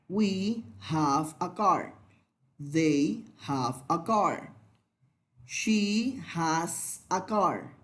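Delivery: slow, clear model sentences for repeating; slow drill. Shown as a demonstration. Teacher drilling have and has with students, slowly. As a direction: say each sentence slowly so the class can repeat. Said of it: "Vamos a practicar".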